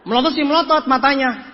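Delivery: usual speaking voice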